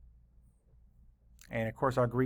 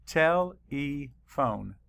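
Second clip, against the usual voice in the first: Tele-phone said slowly, split into its two parts, tele and phone; syllables split; much slower than usual. Shown as a demonstration. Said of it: "Let's count the syllables".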